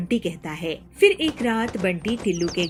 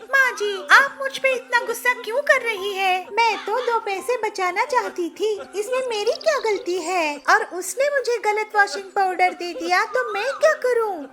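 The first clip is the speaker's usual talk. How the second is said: high, louder whiny protest; young bride complaining, pitched way up; whining bride; 13 semitones higher, 3 dB louder than usual. Acted. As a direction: plead your innocence, high and teary. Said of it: "अब ये रोना धोना छोड़".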